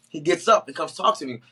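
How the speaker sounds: normal talking voice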